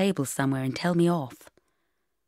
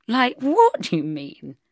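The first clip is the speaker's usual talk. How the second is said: put-on childish; 6 semitones higher, 5 dB louder, slower than usual. A narrator's reading. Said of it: "I said childishly".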